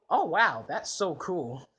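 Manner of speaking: lightly voiced low friend; impressed low-voiced friend; low character voice.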